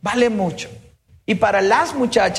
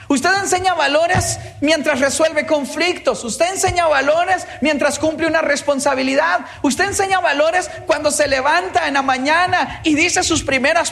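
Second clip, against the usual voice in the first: higher, building sermon cadence on each repeated line; preaching at full force; 5 semitones higher than usual. Acted. Speaker preaching to parents about teaching values. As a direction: preach it like a pastor driving the point home.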